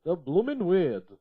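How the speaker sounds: loud, slow, odd character voice; a slow, loud, put-on storybook character; Charles's voice. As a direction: drag it out in a silly put-on voice. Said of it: "said Charles".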